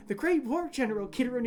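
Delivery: eager, high pitch on The Great War; a kid gushing about the war hero; overexcited boy. Acted. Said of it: "boy who's too excited".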